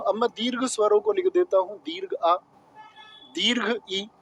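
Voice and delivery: normal talking voice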